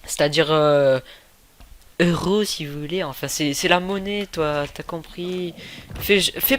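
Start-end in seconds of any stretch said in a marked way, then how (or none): none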